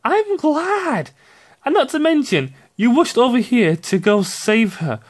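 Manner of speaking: mock the son as gleeful; mock son's voice. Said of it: "It's like".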